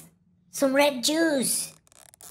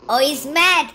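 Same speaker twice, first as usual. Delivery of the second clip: ordinary delivery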